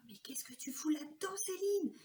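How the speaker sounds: hushed, breathy aside to herself; whispered self-reproach. Retold or imitated. Woman whispering to herself at her desk, reproachful. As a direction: whisper it as if scolding yourself.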